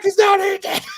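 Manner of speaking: loud, high echo of the line; mock shouted taunt. Friend joining the dinner-scene bit, loud mock yell. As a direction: yell it like a furious host.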